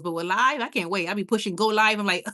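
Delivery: ordinary delivery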